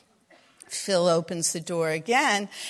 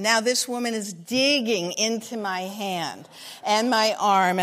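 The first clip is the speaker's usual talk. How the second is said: ordinary talk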